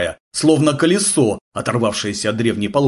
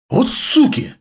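high-pitched, indignant exclamation; hurt outcry; 13 semitones higher, slower than usual. A narrator's reading. Deniska reacts to the attackers, hurt outcry. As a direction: cry out high, stung and offended. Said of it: "с обидой выкрикнул Дениска".

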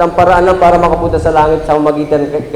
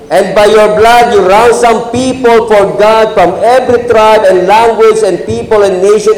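raised, fervent sermon voice; preaching; 7 semitones higher, 4 dB louder than usual. Acted. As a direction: preach it out to the congregation. Pastor preaching at raised pitch.

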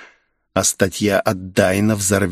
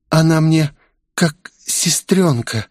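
breathed-out, halting request; exhaled plea; 6 semitones higher than usual. A narrator's reading. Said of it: "выдохнула Оливия".

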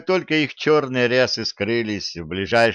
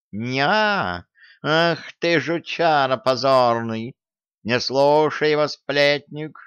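raised, higher cat voice crying out; a hint of a howling tomcat; yelling cat; 5 semitones higher, slower than usual. A narrator's reading. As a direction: yowl it in outrage as the cat. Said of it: "завопил Баюн".

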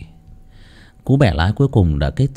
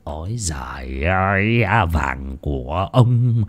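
slow, drawn-out crazed murmur with chuckles; gleeful muttering; slower than usual. Acted. Lão Tuân heading for his gold, muttering with mad glee. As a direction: savour each word, giggling to yourself. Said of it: "miệng cứ lẩm bẩm".

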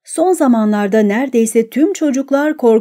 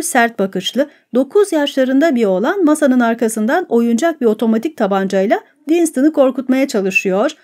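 usual speaking voice

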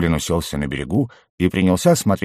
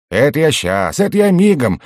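high, louder gleeful shout; joyful cry; 7 semitones higher, 4 dB louder than usual. A narrator's reading. Happy goblin dashing off to hunt for bait, shouting joyfully. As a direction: call out eagerly, rising high. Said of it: "закричал радостный гоблин".

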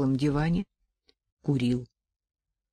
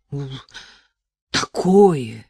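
ordinary delivery

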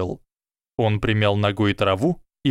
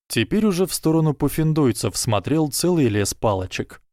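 plain reading voice

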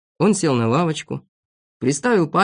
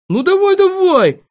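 very high, loud goading; shrieked, combative dare; cocky challenge; 7 dB louder than usual. Acted. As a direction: square up like a fighting rooster. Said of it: "как петух наскакивал на него".

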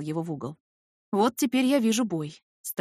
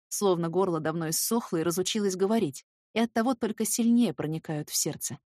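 usual speaking voice

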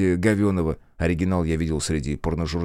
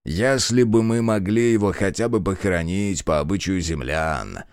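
usual speaking voice

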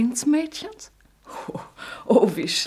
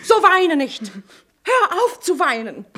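urgent plea; 7 semitones higher, 5 dB louder than usual. Acted. Mother urges son to stop crying.

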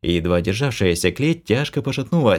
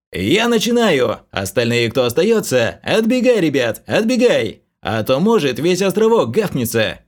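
loud urgent warning shout; 7 semitones higher, 3 dB louder than usual. A narrator's reading. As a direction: yell at everyone to clear off. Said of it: "крикнул Флориан".

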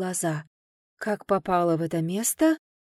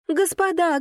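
high, louder call to the masters; excited shout; 9 semitones higher, 3 dB louder than usual. A narrator's reading. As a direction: cry out high with joy. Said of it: "она ахнула и закричала".

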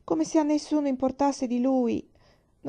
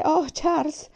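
relieved cry; 6 semitones higher, 4 dB louder, slower than usual. A narrator's reading. Mother's relief after crossing.

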